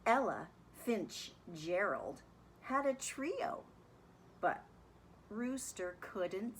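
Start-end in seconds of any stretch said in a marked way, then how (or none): none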